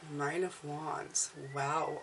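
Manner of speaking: low, hushed, slow aside to oneself; musing aside. Real, not acted. Reader pondering the cards to herself, musing quietly.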